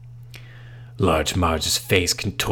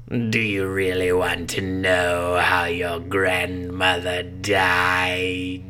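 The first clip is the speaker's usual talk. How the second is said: ominous slow menace; much slower than usual. Acted. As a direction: drag it out with menace.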